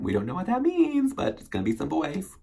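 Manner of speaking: squeaky high teasing; high playful. Real, not acted. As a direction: pitch way up in jest. Playful mystery.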